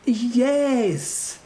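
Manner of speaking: high, tense character voice; irate foreign herald. Acted. Herald confirms his demand, high and irate.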